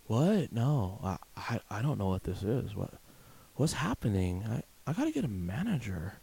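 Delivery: mock panicky cashier, stammering; lightly voiced confused cashier; clueless cashier. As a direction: play a lost, helpless clerk. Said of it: "And you look and you go".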